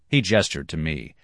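ordinary delivery

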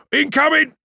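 loud, very high alarm call; urgent high shout; shouted warning. Acted. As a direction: yell the warning to the crew. Warning of incoming attack.

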